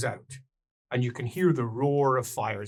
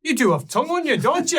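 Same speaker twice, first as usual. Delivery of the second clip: higher, louder mock scolding; teasing old dwarf; 11 semitones higher, 5 dB louder, faster than usual. Dwarf woman teasing the mouthy adventurer, higher and louder. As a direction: needle the cheeky one, lightly voiced dwarf.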